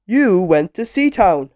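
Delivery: loud, punchy character line; lightly voiced, loud storybook figure; Mister Clever. A narrator's reading. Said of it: "said Mister Clever".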